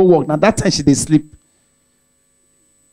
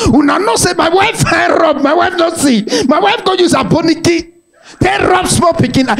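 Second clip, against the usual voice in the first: punchline shout; 8 semitones higher than usual. Real, not acted. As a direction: shout it out to the crowd, high.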